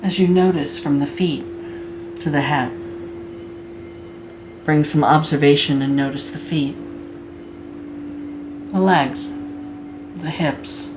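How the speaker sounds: guided meditation. Acted. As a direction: guide them gently into body awareness, unhurried.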